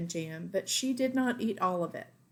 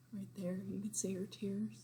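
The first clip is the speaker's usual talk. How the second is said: whisper; 11 dB quieter than usual. Real, not acted. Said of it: "reader whispers aside".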